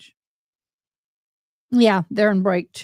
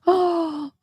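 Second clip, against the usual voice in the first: surprised gasp; 8 semitones higher than usual. Real, not acted. Reading news of friend's baby.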